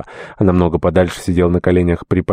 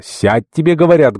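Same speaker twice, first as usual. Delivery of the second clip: much higher, insistent narrated shout; louder shouted order; 9 semitones higher, 3 dB louder than usual. A narrator's reading. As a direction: order them down with a sharply raised pitch. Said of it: "громче крикнул Термосесов". (настойчивый окрик на высоких нотах)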